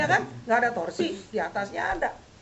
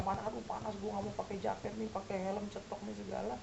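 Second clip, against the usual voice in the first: mock sulky muttering, much softer; grumbling under breath; 12 dB quieter than usual. Biker grumbling about heat in morning.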